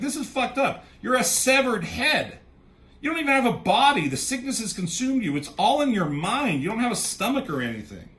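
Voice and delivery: high-pitched, emphatic imitated protest; acted-out incredulous scolding; mock exasperation. Retold quarrel with the severed head.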